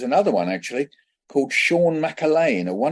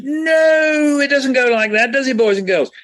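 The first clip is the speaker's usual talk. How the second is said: high, loud West of Ireland teacher; West of Ireland accent; 13 semitones higher, 6 dB louder than usual. Retold or imitated. Irish deputy head correcting the poet in West of Ireland accent. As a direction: West of Ireland exclamation, much higher. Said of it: "in a very strong West of Ireland accent".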